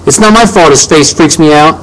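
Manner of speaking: high, upset protest; crying out. A narrator's reading. Boy defending himself to parents, crying out. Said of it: "I cried".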